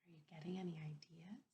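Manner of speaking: fast hushed whisper; teacher models whispering predictions. Shown as a demonstration. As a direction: whisper it like a secret to the class. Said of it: "Whisper in your hand".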